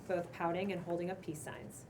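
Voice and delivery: ordinary talk, nothing marked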